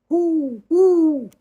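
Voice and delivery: very high, loud hooting owl call. Acted. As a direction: hoot like a little owl. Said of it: "the little owl that says".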